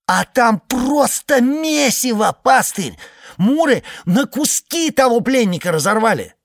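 high, loud, agitated telling; excited high report. Acted. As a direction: blurt it out, worked up. Iceman describes gore.

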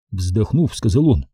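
usual speaking voice

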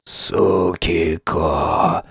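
very slow, low, weak rasp; wheezing croak. A narrator's reading. Wounded friend calls from the ground, croaking. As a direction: wheeze the name out softly. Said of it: "внезапно прохрипел лежащий Ван".

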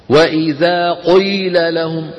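the قيل said with an exaggerated heavy, thick ق; overdone heavy qaf. Shown as a demonstration. Teacher demonstrating the wrong, overdone qaf. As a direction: overpronounce the qaf in قيل deliberately. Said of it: "بالغ في القاف المكسورة".

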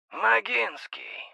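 metallic voice. A narrator's reading. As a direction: speak as if through a metal pipe. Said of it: "Голос изменился до неузнаваемости. Металлический".

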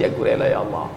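a faint, breathless whisper to God; whispered plea. Acted. A penitent sinner whispering his surrender to God. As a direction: hush it out as a last plea.

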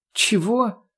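high, outraged yelp of disbelief; indignant. A narrator's reading. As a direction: shoot the question up in shock. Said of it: "выплюнула от возмущения".

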